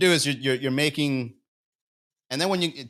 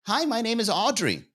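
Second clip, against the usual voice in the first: put-on girl's reply; 8 semitones higher than usual.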